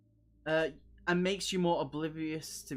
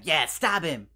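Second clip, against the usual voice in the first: loud, high eager mock shouting; hammed-up cry for violence; mock bloodthirsty; 6 semitones higher, 9 dB louder than usual. Teasing a viewer who urged stabbing, mock eager shout. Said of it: "before you're like".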